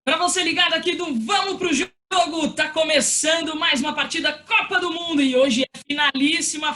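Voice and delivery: loud, high football radio call; live play-by-play. Shown as a demonstration. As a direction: call the match live, building. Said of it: "vamos lá".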